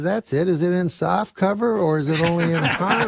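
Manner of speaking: high-pitched, oblivious customer voice. Acted. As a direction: pitch it high, cheerfully ignoring the insult. Redneck's punchline reply in joke.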